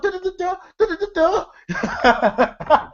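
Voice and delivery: high rapid-fire stutter breaking into laughter; mock stuttering. Damon stuttering under questioning, imitated as a high stammer. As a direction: stammer helplessly on one syllable, up high. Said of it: "And then Damon stuttering".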